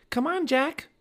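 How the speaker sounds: high-pitched, urgent call. A narrator's reading. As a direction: call someone over to join you. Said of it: "Annie called".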